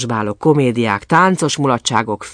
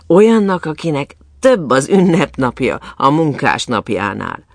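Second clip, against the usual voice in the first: plain reading voice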